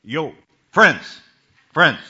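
big shouted "Friends." across the water; calling out loud. Acted. Jesus shouting to the disciples in the boat. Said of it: "called out to them".

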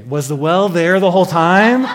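loud, rising, incredulous question; can't believe it was there all along; mock incredulity. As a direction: raise voice and pitch in comic disbelief. Jokes about the unnoticed well.

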